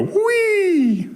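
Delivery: high, long squealed "wee"; loud "wee". Shown as a demonstration. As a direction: stretch a playful high whee for kids to copy. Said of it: "Can you give me some loud words?".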